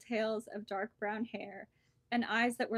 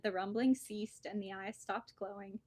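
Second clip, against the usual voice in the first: ordinary delivery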